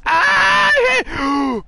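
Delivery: high-pitched yell of shock; startled scream. Real, not acted. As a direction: cry out, pitch shooting up. Startled yell during game play.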